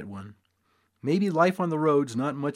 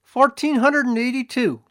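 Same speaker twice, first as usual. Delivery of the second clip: loud, much higher sharp bark; barked answer; 11 semitones higher, 8 dB louder than usual. A narrator's reading. Assayer barks the sum at the restless crowd, loud. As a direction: bark the number out. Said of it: "he barked out".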